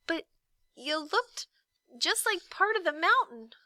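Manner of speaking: high, timid apology; meek boy. A narrator's reading. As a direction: shrink away as you apologise. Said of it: "said Milo meekly".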